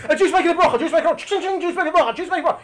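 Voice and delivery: high, excited repeated announcement; hammed-up heavenly buzz, pitched high; excited angels' chatter.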